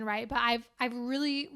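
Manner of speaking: ordinary delivery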